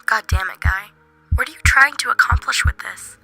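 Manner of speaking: angry scolding. Acted. Inner voice demands Guy explain himself, angry and loud. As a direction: scold him forcefully.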